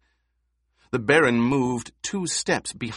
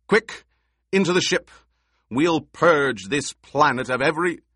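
urgent shout; 5 semitones higher, 4 dB louder than usual. Acted. Emperor urges escape.

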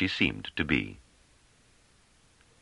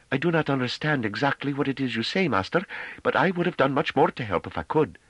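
a hesitant young woman, voiced high; unsure and gentle, lifted higher; tentative; 10 semitones higher than usual. A narrator's reading. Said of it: "Novi said tentatively".

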